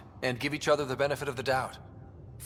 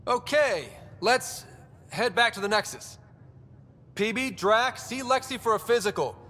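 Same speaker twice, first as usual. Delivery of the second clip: raised, higher voice taking charge; brisk orders; 8 semitones higher than usual. Acted. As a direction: wrap it up and send the team off. Leader wraps up, giving orders.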